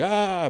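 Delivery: high acted "aah"; mock panicked scream. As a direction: cry out in pretend panic. Retold scream on one-way street.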